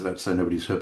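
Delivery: ordinary talk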